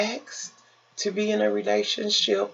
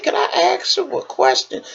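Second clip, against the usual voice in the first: lady's voice; 5 dB louder than usual. Retold or imitated. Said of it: "She said".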